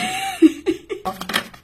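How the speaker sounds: high loud burst of laughter. Real, not acted. Reader cracking up at her own words. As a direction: crack up loudly.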